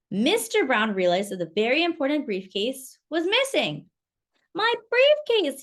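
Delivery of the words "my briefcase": high, loud cry of alarm. A narrator's reading. Mister Brown discovering his briefcase is gone, high alarmed cry. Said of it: "he said".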